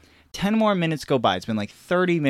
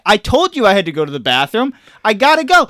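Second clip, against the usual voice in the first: put-on loud, high kid backtalk; rising acted exasperation; mock indignant protest; 7 semitones higher, 8 dB louder than usual. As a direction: insist like a desperate child arguing. Kid argues back, acted quarrel.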